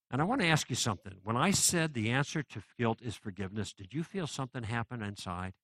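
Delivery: ordinary delivery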